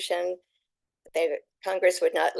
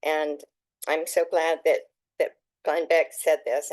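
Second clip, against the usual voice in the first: normal talking voice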